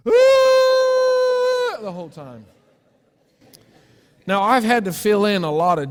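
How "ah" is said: long, high, loud terrified wail; child's scream. Retold or imitated. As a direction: scream like a drowning little boy. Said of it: "I am standing on the gravel bank doing this".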